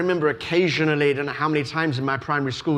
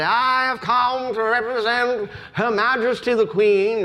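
put-on high, pompous English accent; strange English accent; 6 semitones higher than usual. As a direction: proclaim it with great pomp in an exaggerated English accent. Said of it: "a very strange English accent".

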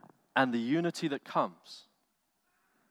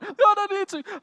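much higher and louder, "God" cried out in anguish; imitating the smoker's plea rising to a louder cry; desperate pleading; 7 dB louder than usual. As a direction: push the plea to breaking point. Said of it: "He's like".

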